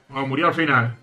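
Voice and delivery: ordinary talk, nothing marked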